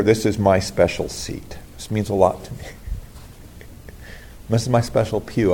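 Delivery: soft, lightly mocking churchgoer voice; mock-precious pew owner, half-laughing at the end; a hint of a possessive churchgoer.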